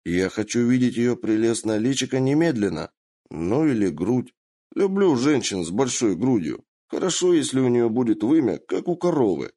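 put-on leer it out like a drunken lord; crude drunk prince.

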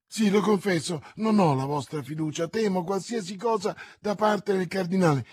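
loud, high, hurried confession; agitated. Acted. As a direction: blurt it out anxiously. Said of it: "come sembrate agitata".

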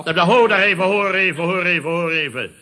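loud blustering hold on; loud protest. Acted. Chairman cuts off his club members, loud protest. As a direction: raise your voice to cut them off.

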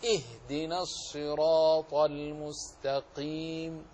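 slow, measured Quranic recitation; tajweed recitation, slow. Shown as a demonstration. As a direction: recite it slowly, letters held clear. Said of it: "فنحرص على إظهاره".

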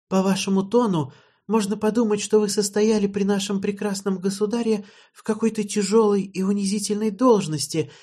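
a high, mocking young woman's voice; teasing princess. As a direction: needle him with sweet scorn. Jennera teasing Kyle.

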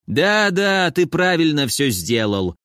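put-on giggling old man. A narrator's reading. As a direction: giggle through it, sly. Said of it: "хихикнул старик".

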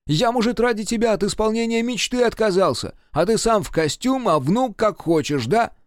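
high-pitched aggrieved complaint; indignant reproach. A narrator's reading. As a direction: pour out your grievance at Grandpa. Grandson reproaches grandpa. (обиженно возмущаться)

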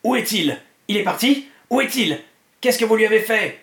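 high, mounting distress; anguished. A narrator's reading. Trashcan accuses them over his missing master, anguished. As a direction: demand it desperately, building to a loud accusation. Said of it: "d'une voix angoissée".

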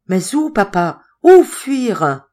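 distressed wail, high and loud at the end. A narrator's reading. Madeleine asks her father where to flee, wailing. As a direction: moan it helplessly, climbing on the last question. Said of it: "gémissait Madeleine".

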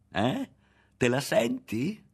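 loud, high, needling question; goading. A narrator's reading. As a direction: push him to answer. Berto goads Ario.

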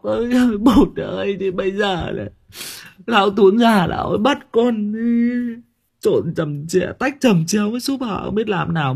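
high, whiny mock sob as Tấm; mock crying Tấm. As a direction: wail to Bột like a hammed-up crying girl. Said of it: "Tấm ngồi khóc". (giọng Tấm khóc lóc giả vờ, cao và mếu máo)

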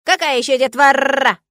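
surprised raven. A narrator's reading. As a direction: squawk in disbelief. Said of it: "удивился Арчи".

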